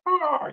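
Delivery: high squawk imitating an eagle; eagle screech. Imitating a bald eagle cry.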